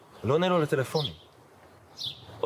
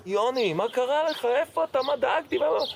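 high, alarmed voice of the rabbi on the phone; worried rabbi; 13 semitones higher than usual. Retold or imitated. Rabbi answering a call after two months, voiced high and alarmed. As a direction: let the worry burst out, pitched way up.

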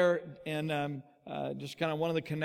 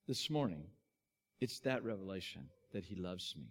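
very soft, low closing words; hushed sincerity; 8 semitones lower, 10 dB quieter than usual. Real, not acted. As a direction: bring it down gently to land the message. Quiet close of the sermon.

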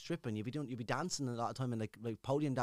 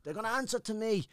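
high, raised barked decree; mock sergeant's orders; 11 semitones higher, 5 dB louder than usual. Sergeant major renaming him on parade, high, mock-commanding. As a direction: bark it out like a drill sergeant handing out a nickname. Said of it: "he goes".

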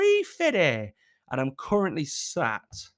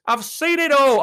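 "I've seen it all" much louder; loud repeat; 8 semitones higher, 10 dB louder than usual. Real, not acted. Repeating to viewers, far louder.

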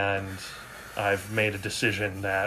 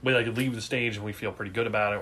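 ordinary talk, nothing marked

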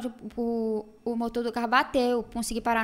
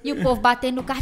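ordinary delivery

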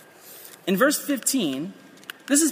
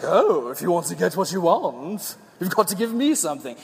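sea witch voice; 3 semitones lower than usual. Retold or imitated. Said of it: "And she's like".

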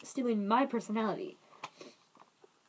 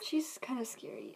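whispered aside; hushed, secretive comment; whispering; 9 dB quieter than usual. Real, not acted. Aside about someone.

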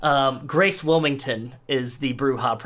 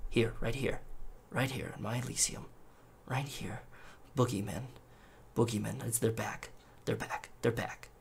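soft, eerie whispery muttering, much softer; a prince lost in his own head, softly muttering; creepy murmur; 10 dB quieter than usual. Acted. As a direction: murmur it to himself like a madman. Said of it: "you can just hear him go".

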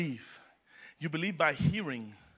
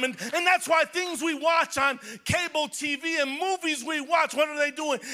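high, loud preaching shout climbing higher; full-force preaching; 7 semitones higher, 3 dB louder than usual. Real, not acted. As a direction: let the spirit lift you into a ringing shout.

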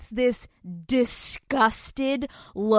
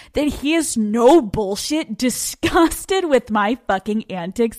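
ordinary delivery

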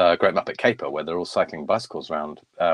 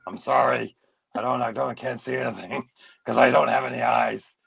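put-on confused old potato puppet character; 4 semitones higher than usual. Old eyeless puppet grumbling to the interviewer, confused character voice. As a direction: play a muddled, apologetic old puppet.

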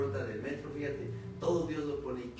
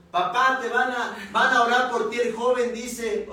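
raised, much louder daughter's yell; imitated shout; 9 semitones higher, 12 dB louder than usual. Daughter yelling the news to her half-deaf father, imitated shout. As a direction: shout it as if to someone hard of hearing. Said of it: "la hija que le grita, le gritó".

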